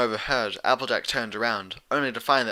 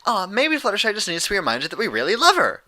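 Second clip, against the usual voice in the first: high, louder bubbly pony voice; chirpy Pinkie Pie; 10 semitones higher, 5 dB louder than usual. A narrator's reading. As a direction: chirp it out sweetly. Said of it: "Pinkie Pie chirped".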